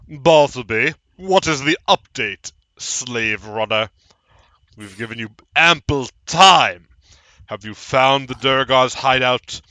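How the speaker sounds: loud, commanding boss voice; demanding master calling for a report; villain boss on the shell. Acted. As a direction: bark orders through the shell at your underling.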